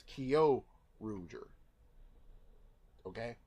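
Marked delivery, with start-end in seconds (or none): 0.0-1.5 "Kyo" settled on as KEE-oh; pronounced kee-oh. Shown as a demonstration. Reviewer settling on a pronunciation for the review, "Kyo" as kee-oh. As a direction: say "Kyo Ruger" as KEE-oh ROO-jer, slowly. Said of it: "That's how I'm gonna say it".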